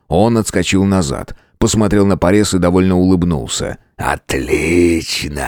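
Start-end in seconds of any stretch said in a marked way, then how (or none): 4.0-5.5 low, slow, rasping beast; rasping monster voice. A narrator's reading. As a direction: rasp it out like an ancient undead king. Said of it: "прохрипел монстр".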